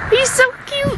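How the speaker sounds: high squeal of delight; overwhelmed by cuteness. Real, not acted. First koala spotted, cooing.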